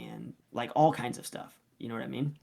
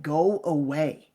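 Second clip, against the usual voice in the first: very slow, louder, drawn-out order; retold exasperated dismissal; 2 semitones higher, 5 dB louder, much slower than usual. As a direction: act out sending a pest off, word by word. Retold coach dismissing angry dad.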